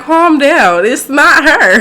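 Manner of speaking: loud, high mock exasperation; exasperated calming. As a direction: imitate a coworker loudly telling her to settle down, breaking into a laugh. Acts out coworker's exasperated reply.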